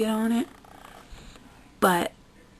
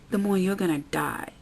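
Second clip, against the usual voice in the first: ordinary talk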